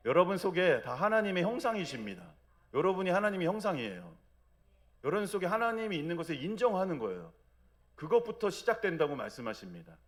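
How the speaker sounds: high, loud pulpit proclamation; full-force preaching. Real, not acted. Pastor proclaiming to the congregation, loud and high. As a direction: drive the truth home to the whole church.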